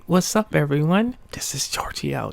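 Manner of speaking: plain reading voice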